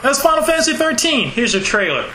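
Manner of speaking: high, hammed-up presenter voice; mock announcer. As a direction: play the smug company rep. Mocking Square's press show.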